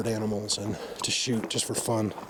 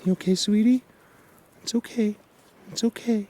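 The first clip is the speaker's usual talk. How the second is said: cooing to a fawn; 9 semitones higher, 3 dB louder than usual. Real, not acted. Soothing frightened fawn.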